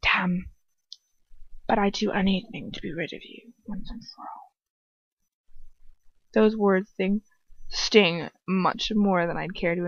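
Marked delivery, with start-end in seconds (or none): 0.0-4.5 venomous mutter. A narrator's reading. Lucius wishing to be rid of her, venomous mutter. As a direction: mutter it venomously under the breath. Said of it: "Lucius mutters venomously".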